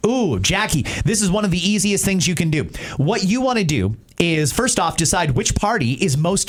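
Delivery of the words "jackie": ordinary talk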